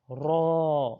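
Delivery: را said full and heavy, loud; a thick recited را. Shown as a demonstration. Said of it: "اور یہ بھی موٹا پڑا جائے گا". (را کو موٹا پڑھیں)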